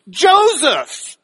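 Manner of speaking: "Joseph" high, loud and falling; "Joseph" punched as the tense key word; tense emphasis. Shown as a demonstration. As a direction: call out "Joseph" with dramatic force. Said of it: "a little bit more tense with the next word of emphasis".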